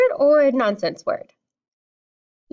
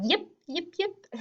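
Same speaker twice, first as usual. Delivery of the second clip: high, soft little yips; playful high-pitched dog imitation; yipping dog; 7 semitones higher, 6 dB quieter than usual. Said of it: "if you hear a dog".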